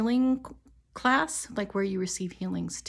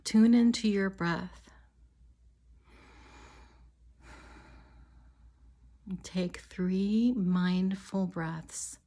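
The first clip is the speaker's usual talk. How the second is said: calm, measured meditation voice with long pauses; guided meditation. Real, not acted. Healer leading listeners on an imagined walk to a river, guided meditation. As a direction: guide them gently, leaving space after each phrase.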